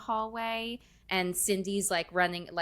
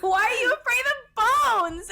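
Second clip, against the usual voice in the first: much higher, louder mock yell; imitated yelling; 7 dB louder than usual. Host re-enacting the skeleton scene for friends, yelled. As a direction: shriek the movie line in disbelief.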